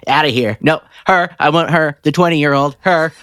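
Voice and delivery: high-pitched, curt dismissive demands; Danny DeVito. Retold or imitated. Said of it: "Danny DeVito is so funny. It's like".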